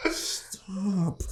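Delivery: high, soft little plea; mock-timid high "stop" from the cloaked guy; meek plea. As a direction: squeak it out timidly, like a cornered man. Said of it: "the guy in the cloak was like".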